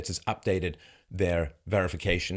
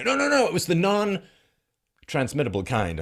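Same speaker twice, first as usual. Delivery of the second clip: high, slow, insistent imitation of an excuse; hastily object, mimicking a defender; exaggerated backpedalling denial; mock protest; 10 semitones higher, 4 dB louder, slower than usual. Acts out a hasty excuse.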